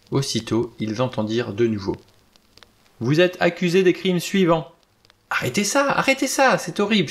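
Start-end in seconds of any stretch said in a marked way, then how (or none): 3.0-4.7 high, sustained, declaiming monotone; accusing recorded voice. A narrator's reading. Gramophone's accusing voice replayed. 5.3-7.1 very high, frantic plea; horrified cry. A narrator's reading. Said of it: "s'écria Vera".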